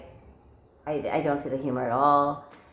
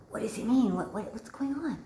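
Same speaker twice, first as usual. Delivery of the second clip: higher, softening hushed question; imitated whisper; 4 semitones higher, 6 dB quieter than usual. Woman whispers to her husband, hushed and puzzled. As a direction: whisper urgently to someone close, half-voiced. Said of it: "I whisper to him, like".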